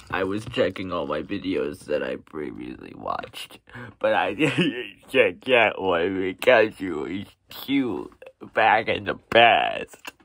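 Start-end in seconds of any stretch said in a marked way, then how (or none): 4.0-10.3 louder, slow, laughing through the excuse; laughing while owning up. Real, not acted. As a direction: giggle out the words, getting louder. Giggling through a silly excuse.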